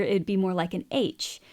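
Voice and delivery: ordinary talk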